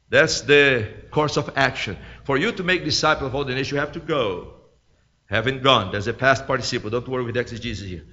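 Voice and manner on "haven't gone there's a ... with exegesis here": low, soft and quick; technical aside. Real, not acted. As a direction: toss it off quietly under the sermon.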